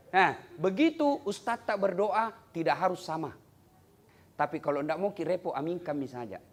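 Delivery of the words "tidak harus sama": ordinary talk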